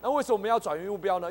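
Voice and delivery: usual speaking voice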